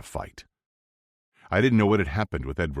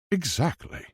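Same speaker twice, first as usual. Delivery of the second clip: smug posh English; 11 semitones higher than usual. A narrator's reading. Edward agreeing smugly.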